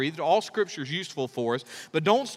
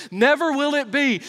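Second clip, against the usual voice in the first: Peter protesting; 9 semitones higher, 6 dB louder than usual. Retold or imitated. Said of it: "he said".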